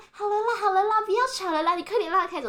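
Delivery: high, pleading imitation of an old lady; peacemaking wife. Imitating the wife stopping her husband's quarrel, high and urging. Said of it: "然后他老婆就说".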